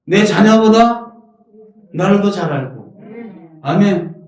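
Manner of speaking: normal talking voice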